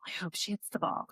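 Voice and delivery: whispered nervous hope; anxious whisper. Retold or imitated. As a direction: whisper it like a silent prayer in the stands. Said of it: "'cause I was like".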